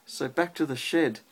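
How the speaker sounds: rhythmic storybook read-aloud; reading to a child. Acted. Parent reads rhyming robot book to child, lilting read-aloud. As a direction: read the rhyme to a little one at bedtime.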